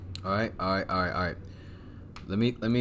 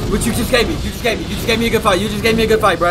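hyped yelling; 9 semitones higher, 7 dB louder, faster than usual. Real, not acted. Taunting the boss, yelling.

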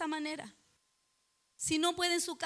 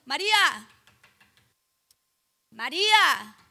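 loud, drawn-out name call; 10 dB louder, slower than usual. Acted. Preacher acting the Lord calling a believer, calling out. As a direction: call someone from outside, leaving room to answer. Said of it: "cuando los llama de esa manera".

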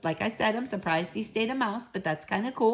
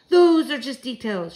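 loud, higher exclamation; crying out; 6 semitones higher, 8 dB louder than usual. A narrator's reading. Grandmother dismisses the child's worries, crying out. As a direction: wave it away in a loud voice. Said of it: "she cried".

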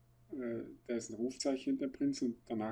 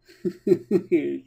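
put-on drunken giggle; 7 semitones higher, 12 dB louder than usual.

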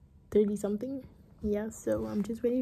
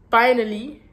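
relieved shout; 3 semitones higher, 14 dB louder than usual. Real, not acted. Arrived at friend's house at last.

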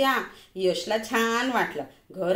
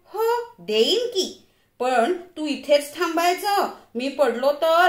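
put-on higher, slower eager little-boy voice; a thrilled kid agreeing to climb up; a child bursting with eagerness; excited child; 5 semitones higher, 3 dB louder, slower than usual. A narrator's reading. Excited child answering mother.

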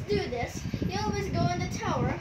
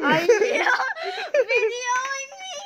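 laughing surprise; 7 semitones higher, 8 dB louder than usual. Real, not acted. Notices dad filming him.

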